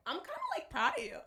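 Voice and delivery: squeaky high praise; high teasing. Real, not acted. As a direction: pitch it way up, playful.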